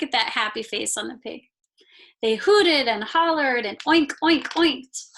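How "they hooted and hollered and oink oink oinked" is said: higher and louder, raucous pig noises; rowdy hollering. A narrator's reading. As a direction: holler it like happy pigs. Pigs hooting and hollering.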